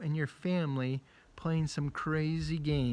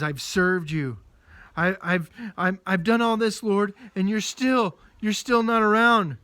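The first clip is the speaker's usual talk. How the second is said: desperate Elijah; 5 semitones higher, 7 dB louder than usual. Acted. Elijah protests again to God.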